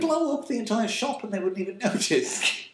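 high-pitched, indignant outburst; heated rant. Real, not acted. Arguing with co-host about giant corporations, heated high-pitched rant.

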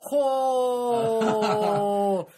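put-on high, loud Geordie accent, slow and stretched. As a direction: camp up the Geordie vowels. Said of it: "the [name] goes".

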